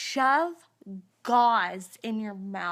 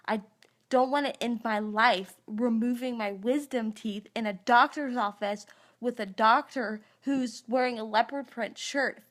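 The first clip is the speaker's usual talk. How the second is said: ordinary talk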